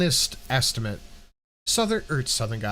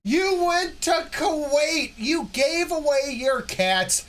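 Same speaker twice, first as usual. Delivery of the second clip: high, slow fake sobbing; mock crying; 11 semitones higher, slower than usual. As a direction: wail it like a fake cry. Mock crying about her life change.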